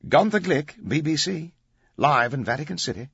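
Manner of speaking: bright, loud broadcast delivery; anchorman voice. Acted. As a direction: announce it like a TV anchor. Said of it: "in his best anchorman voice".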